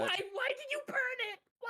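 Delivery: very high, squealed disbelief; high-pitched mock-horrified complaint; incredulous protest. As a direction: wail the question way up high. Game master reacts to burning hair.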